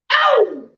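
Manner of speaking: sudden high yelp; showman's high ow; hype yelp. Acted. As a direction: whoop it up to kick off. Exclamation opening the show.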